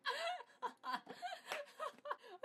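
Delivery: cackling laugh up high; high wheezing laugh. Real, not acted. To viewers about the censor, a high wheezing laugh.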